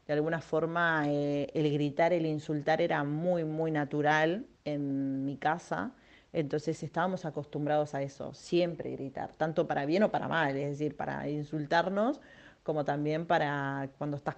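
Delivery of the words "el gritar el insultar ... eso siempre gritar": ordinary delivery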